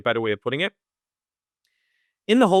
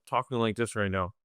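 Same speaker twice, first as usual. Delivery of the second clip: low, soft mumble; mumbling; 5 semitones lower, 6 dB quieter than usual. Shown as a demonstration. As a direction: mumble it without effort. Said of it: "if I was to start wrong".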